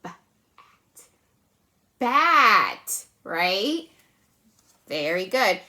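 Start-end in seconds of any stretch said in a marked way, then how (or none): 0.0-1.0 b- and at whispered apart; b-, at whispered as separate sounds. Shown as a demonstration. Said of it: "add a b sound to it". 2.0-3.0 Bat said out loud and a bit higher; blended answer. Shown as a demonstration. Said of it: "add a b sound".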